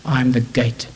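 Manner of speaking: fervent sermon voice, rising and falling; preaching. Acted. Preacher urging the congregation to find Christ, fervent sermon.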